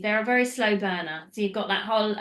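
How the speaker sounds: ordinary talk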